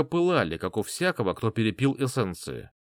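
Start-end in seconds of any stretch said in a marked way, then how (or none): none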